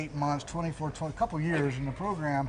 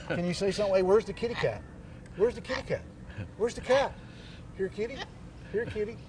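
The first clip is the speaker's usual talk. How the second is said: calling a pet; 5 semitones higher, 4 dB quieter than usual. Real, not acted. Coaxing the bird to talk.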